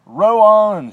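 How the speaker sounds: high ringing shout to the rowers. A narrator's reading. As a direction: yell it out big and high. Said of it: "shouted Mister Wonka".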